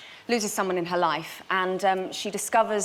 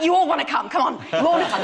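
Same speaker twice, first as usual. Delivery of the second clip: louder, higher coaxing call; 7 semitones higher, 7 dB louder, faster than usual. Retold or imitated. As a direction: cheerfully urge them to come in. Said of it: "I'm like".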